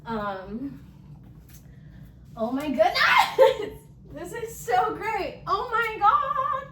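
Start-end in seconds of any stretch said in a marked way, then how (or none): 2.3-6.7 overjoyed. Real, not acted. Vlogger marvels at her new furniture, overjoyed and laughing. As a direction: gush with delight, near tears of joy.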